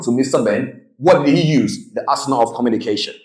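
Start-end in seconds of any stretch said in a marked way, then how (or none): none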